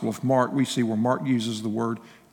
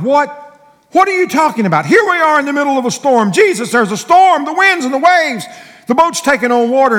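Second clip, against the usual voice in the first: high, loud outraged protest; acted frantic yelling at Jesus; panicked incredulous shouting; 13 semitones higher, 12 dB louder than usual. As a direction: shout over a raging storm in disbelief. Acted disciples protesting in the storm.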